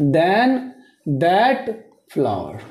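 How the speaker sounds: very slow, drawn out; dictating pace; slow dictation. Shown as a demonstration. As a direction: say it slowly for copying. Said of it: "teacher dictating slowly".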